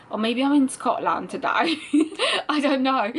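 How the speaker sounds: high, loud, breaking into a real laugh. Real, not acted. Speaker joking about where their accent is from today, laughing. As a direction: say it through rising laughter. Amused by guessing own accent.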